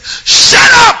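sudden furious shout; explosive high yell. Retold or imitated. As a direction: bark it like an angry elder silencing the room. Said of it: "then prophet said".

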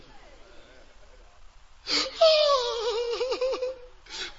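high wailing laugh-sob; crying laugh. Acted. Widow hearing her late husband's code, laughing through tears. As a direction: break into a tearful cackle.

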